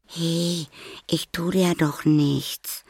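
high, slow coaxing voice; soft reassurance. A narrator's reading. Maya calming the terrified boy, gentle reassurance. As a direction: coax gently, drawing it out up high. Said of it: "sagte sie leise".

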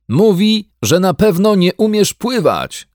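high loud shout across water; shouting back. A narrator's reading. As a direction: shout back over the distance. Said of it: "odkrzyknął pan Brown". (odkrzyknięcie)

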